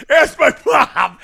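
slow, loud, high mimicry with laughter; mimicked comeback. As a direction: draw out the mock retort, cracking up. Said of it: "you said".

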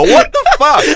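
high, squeaky what-the-fuck; mock disbelief. Real, not acted. Laughing at his cohost's huge bottle, high incredulous yelp. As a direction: act floored by the bottle.

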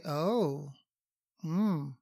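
soft, musing "oh", then "hmm"; pondering oh. Shown as a demonstration. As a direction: draw it out quietly, mulling it over. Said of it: "Now I'm giving a different meaning".

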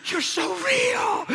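very high, slow, shouted wonder; stretched-out high shout of amazement; ecstatic cry. Retold or imitated. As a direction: cry it out overwhelmed with joy. Reliving encounter with Jesus.

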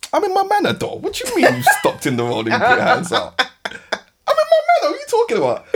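high-pitched outraged disbelief; indignant protest. Retold or imitated. Retelling a challenge to a jaywalker, indignant. Acted roadside confrontation with stranger.

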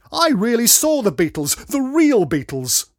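loud, high-pitched insistence; emphatic boast. A narrator's reading. Dad outdoing the so-called real fans, emphatic boast. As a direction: declare it proudly and loudly.

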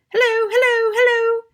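very high, loud parrot call; high-pitched parrot voice, projected loudly; talking parrot. A narrator's reading. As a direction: squawk out a high parrot greeting. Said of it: "Suddenly the parrot said".

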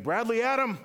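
loud high parent calling the full name; parent scolding. Retold or imitated. Mom or dad calling the child in trouble, loud and high. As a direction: bellow the kid's full name like a mad parent. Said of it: "I used to get".

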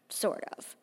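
whispered, sly afterthought; whispered mocking aside; snide aside. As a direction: undercut it under your breath.

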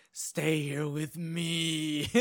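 very slow, drawn-out mock plea; pleading suitor. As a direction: stretch every word like a lovesick suitor. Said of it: "the guy's like".

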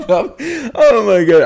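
high, slow, helpless laughing. Real, not acted. Co-host cracking up over the vomiting story, high slow laughter. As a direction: laugh it out slowly.

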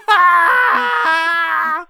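long, high, loud scream; drawn-out comic death scream; mock scream. Podcast host as dying sperm, long mock scream. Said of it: "They're all just screaming".

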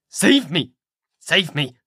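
mild frantic shout; crying out. A narrator's reading. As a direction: plead for rescue, voice up high. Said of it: "he cried".

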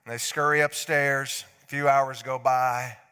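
ordinary talk

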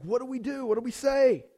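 high, flustered questions; mock freak-out. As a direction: play overwhelmed, half-comic. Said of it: "I'm freaking out".

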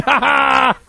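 high loud cackle of glee; gleeful laugh. Real, not acted. Comedian laughing at his own gross habit, gleeful. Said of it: "she's grossed out".

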